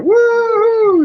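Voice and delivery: very high, loud whoop; imitated wild whoop; mock laughing whoop. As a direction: let out a gleeful high-pitched "woo". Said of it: "he starts laughing. He's like".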